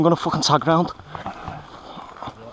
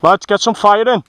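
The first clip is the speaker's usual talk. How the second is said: shouting; 5 semitones higher, 5 dB louder than usual. Real, not acted.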